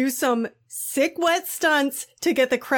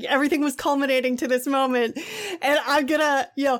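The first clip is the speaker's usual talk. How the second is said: usual speaking voice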